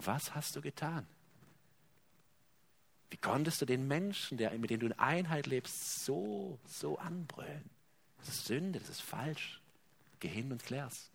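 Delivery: viel leiser, sanft ermahnend; leise Stimme des Geistes. Retold or imitated. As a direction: leise ins Gewissen sprechen. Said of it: "er wird klopfen und sagen". (leise mahnende Stimme)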